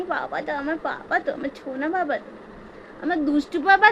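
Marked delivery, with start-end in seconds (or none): 0.1-2.3 mother cooing softly to her little one; tender soft coo. Real, not acted.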